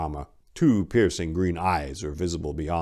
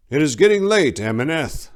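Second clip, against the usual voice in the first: lightly voiced aging monk, raised pitch, weary; an old guard fretting at dusk; old monk Brother; 9 semitones higher, 8 dB louder than usual. Old monk warning his young companion at dusk, weary.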